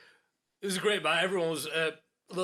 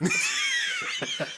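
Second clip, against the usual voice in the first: very high shrieking burst of laughter; real piercing laugh shriek; 4 dB louder than usual. Real, not acted. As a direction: scream with laughter at the irony.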